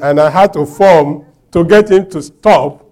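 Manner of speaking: ordinary talk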